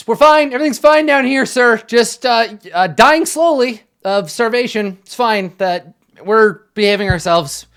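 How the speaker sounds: high, loud nervous cover-up; forced cheery call. Acted. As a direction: call up brightly to the guard, pretending all is fine. Prisoner calling up to guard.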